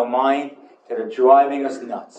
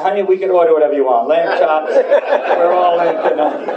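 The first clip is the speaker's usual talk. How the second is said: laughing while quoting; 4 semitones higher, 3 dB louder than usual. Retold or imitated. Retells boasting to wife.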